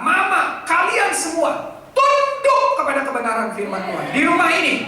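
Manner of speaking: firm proclamation. Acted. Father declaring God's word rules the home, firm loud proclamation. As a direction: proclaim it like a house creed.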